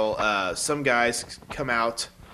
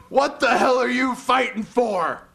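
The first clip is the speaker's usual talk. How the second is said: high, slow, louder hammed-up yell; mock outraged yell; 12 semitones higher, 6 dB louder than usual. Diamond Dallas Page demanding King's reason, mock yell. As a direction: bellow the question in disbelief. DDP yelling the question.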